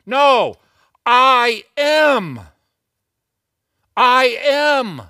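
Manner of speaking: booming, high-pitched divine proclamation; emphatic declaration. Acted. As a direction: thunder it out with absolute authority, each word held.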